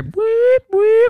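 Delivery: high loud boop sounds; chair beep noise. Retold or imitated. Gamer mimicking the chair's beeps, high loud boops. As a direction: chirp it like a gadget beep.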